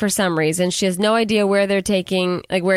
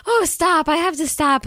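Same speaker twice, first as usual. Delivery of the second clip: mock out of breath; 8 semitones higher than usual. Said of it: "we're out of breath".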